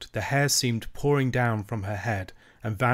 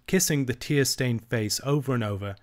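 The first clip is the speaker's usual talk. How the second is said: usual speaking voice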